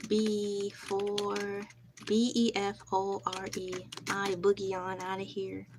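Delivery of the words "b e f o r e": B E F O R E spelled letter by letter. Shown as a demonstration. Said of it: "spells the word out".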